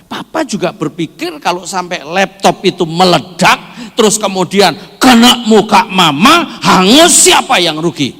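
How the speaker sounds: high, very loud argument building up; heated husband's rebuttal. Retold or imitated. Husband argues back in story.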